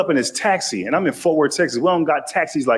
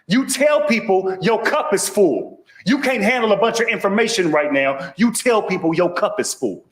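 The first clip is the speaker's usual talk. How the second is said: high, emphatic, drawn-out advice; insistent doctor; 6 semitones higher than usual. Acted. Doctor repeating advice to baffled patient, high and insistent. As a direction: drive the advice home in a raised voice.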